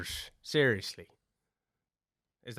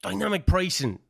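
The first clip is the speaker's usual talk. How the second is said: ordinary talk, nothing marked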